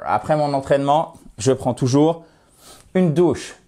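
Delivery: ordinary talk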